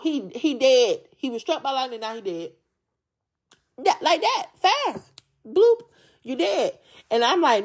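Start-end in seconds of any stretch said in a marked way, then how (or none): 3.8-6.8 dramatic. Real, not acted. Dramatising sudden lightning death.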